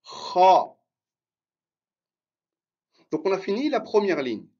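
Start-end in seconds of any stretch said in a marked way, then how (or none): 0.0-0.7 Kha growled low and loud from the throat; little roar, low sound. Shown as a demonstration. Teacher models Kha as a low roar for repetition. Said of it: "avec la bouche ouverte".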